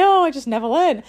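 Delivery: an adult in nappies explaining himself, hammed-up sheepish; mock sheepish adult. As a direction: answer like a grown-up caught out, half joking. Imagined adult's silly reply.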